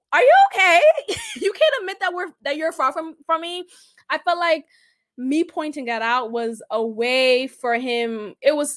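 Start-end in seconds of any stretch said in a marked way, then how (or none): 0.1-3.7 high, laughing disbelief; incredulous challenge. Retold or imitated. Woman arguing with date over distance, high incredulity.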